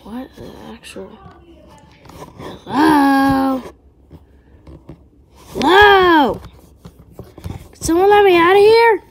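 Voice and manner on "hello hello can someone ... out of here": loud, higher frantic calls; trapped in a coffin, pleading to be let out; desperate calling. Acted. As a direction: beg someone to hear you.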